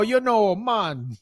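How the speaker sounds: ordinary delivery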